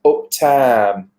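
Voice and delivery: "uptown" in a Notts accent, as "oop-tahn"; local pronunciation. Shown as a demonstration. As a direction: say "uptown" with a long flat "ah" in town. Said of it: "'cause you'll say up".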